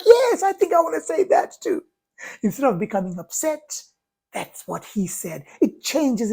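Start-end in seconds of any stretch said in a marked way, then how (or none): 0.0-1.8 higher, brighter mock voice of the gentleman; a laughing man agreeing. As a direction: play him amused and convinced. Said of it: "he's like".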